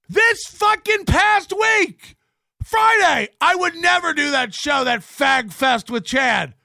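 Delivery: high, loud ranting tirade; furious shout. Real, not acted. Yelling at the guest who keeps flaking, furious. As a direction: yell every broken promise at him.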